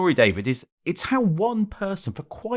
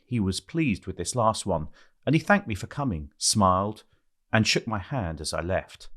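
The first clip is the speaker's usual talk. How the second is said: measured reading voice, low and even; memoir reading; 5 semitones lower than usual. Acted. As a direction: read it like an audiobook passage. Reads memoir aloud over music.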